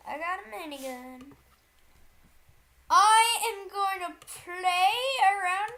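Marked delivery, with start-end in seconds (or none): 0.1-1.3 softer, lower, to yourself; quiet. Real, not acted. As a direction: mutter it, still pleased. Repeats after the shouts.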